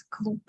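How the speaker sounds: клуб with its b said as p; final B as P. Shown as a demonstration. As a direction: end клуб on a dull p. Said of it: "which I pronounce as".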